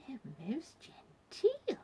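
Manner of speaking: slow, genteel stage whisper. A narrator's reading. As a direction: whisper it slowly and politely to a companion. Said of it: "whispered Vanessa to Jane".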